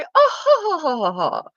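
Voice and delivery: high hammed-up aristocratic titter; a prissy royal giggle, overdone; mock posh laugh. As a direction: trill a snooty upper-class laugh. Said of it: "we're very soft and very".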